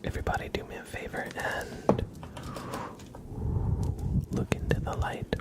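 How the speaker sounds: whispered letter by letter; ASMR whisper. Acted. Tracing a viewer's name letter by letter, whisper.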